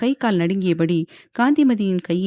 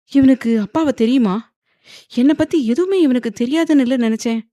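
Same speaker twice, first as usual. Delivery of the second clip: higher-pitched alarmed wondering; frightened; 5 semitones higher, 3 dB louder than usual. A narrator's reading. Wife realising her husband knows her father, frightened. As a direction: fret in rising alarm. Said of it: "வந்தனா பயந்தபடி அவனை பார்க்க".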